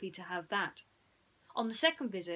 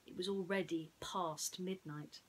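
usual speaking voice

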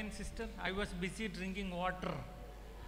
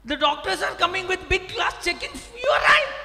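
mocking shout; 10 semitones higher, 12 dB louder than usual. Mocking the eye specialist.

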